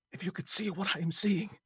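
high frantic trembling cry; shaky whisper. A narrator's reading. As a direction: call up in terror, voice shaking. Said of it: "called from below in a shaky whisper".

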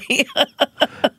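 high bright burst of laughter; real laugh. Real, not acted. Amused laugh at the interviewer's framing. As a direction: let a real laugh break out.

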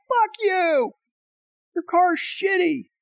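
high mock shout back at the driver; imitated yell. Retelling wife yelling back.

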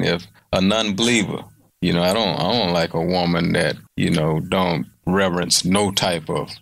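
ordinary delivery

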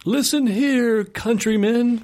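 high, booming mock proclamation; town crier. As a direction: announce it like a medieval herald. Joking entrance at Outback restaurant.

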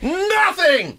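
high, loud outraged "Nothing!"; scoffing burst of outrage; indignant exclamation. Acted. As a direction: fling the word out in disbelief. Mock outrage at last night.

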